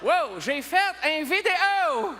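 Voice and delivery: high, slow mock-amazed exclamation; excited older fan. Older fan botching a selfie with a celebrity, mock amazement. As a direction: marvel at it, stretching every word. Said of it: "il y a un homme d'un âge respectable".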